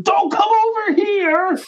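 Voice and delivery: high, slow shouted order; hammed-up high yell; mock shout. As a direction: yell it up high, stretching each word. Imitating shouting host.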